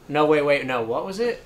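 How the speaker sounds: ordinary delivery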